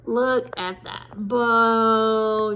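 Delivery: very slow, soft admiring; soft awe. Real, not acted. Crafter showing viewers her bow, slow soft pride. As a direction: linger lovingly on it.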